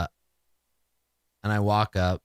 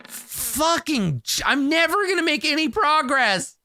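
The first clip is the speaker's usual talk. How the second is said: frustrated outburst; 11 semitones higher than usual. Real, not acted. Player frustrated at failed roll.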